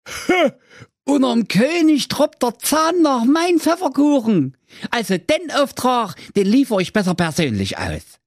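very high goofy character voice; Hans voice. Acted. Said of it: "Da dachte sich der Hans".

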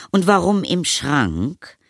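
high, slow probing question; suspicious mother. A narrator's reading. Mother asks about friend in cupboard, suspicious. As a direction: ask it slowly with a questioning lift.

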